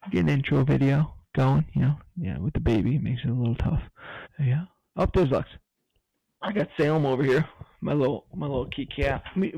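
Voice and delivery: ordinary talk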